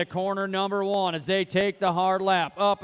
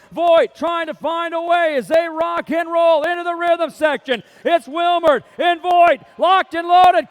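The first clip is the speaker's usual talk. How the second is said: higher and louder, building to a shout at the line; excited race call; 7 semitones higher, 7 dB louder than usual. Real, not acted. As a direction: call the finish at full throttle. Climactic finish of the race call.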